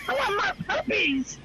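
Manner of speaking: high-pitched villainess wail, half laughing; Cruella de Vil. Acted. Listener teasing the shouting friend, mock villainess impression. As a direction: cry out as a hammed-up villainess, amused. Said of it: "That's Cruella de Vil there".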